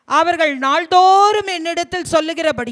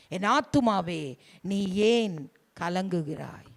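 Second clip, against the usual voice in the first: much softer, lower repeat of the verse; soft fading plea; 7 semitones lower, 6 dB quieter than usual. Real, not acted. Preacher repeats the psalm's plea to his soul, quiet and low. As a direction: let the plea sink to a murmur.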